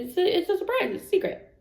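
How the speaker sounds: high then whispered, playful; teasing secret. Retold or imitated. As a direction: tease that it's a secret. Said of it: "He's good".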